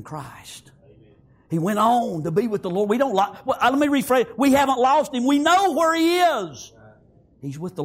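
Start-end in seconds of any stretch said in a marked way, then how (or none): 1.5-6.7 high, loud, ringing praise; full-force preaching. Acted. Pastor rousing the funeral congregation toward rejoicing, preaching loudly. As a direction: lift the church with joyful fire.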